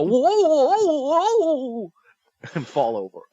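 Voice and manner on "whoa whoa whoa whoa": high panicked whoas; squealing high whoa; high goofy whoa. Retold or imitated. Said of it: "And then he'll go".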